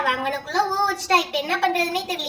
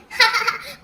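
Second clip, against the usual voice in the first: higher teasing laugh; mocking laugh; 5 semitones higher than usual. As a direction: laugh at the loser. Laughing at the loser. (கேலிச் சிரிப்பு)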